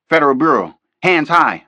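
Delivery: loud commanding shout of armed agents. A narrator's reading. FBI agents ambushing the kidnapper, loud shout. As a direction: bark it out as a police raid.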